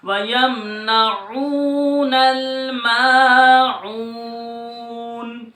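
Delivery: slow, sung tajweed recitation; Quran recitation. Shown as a demonstration. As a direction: chant the verse, stretching the long vowels. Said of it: "عین کی آواز آئے گی". (ترتیل کے ساتھ تلاوت)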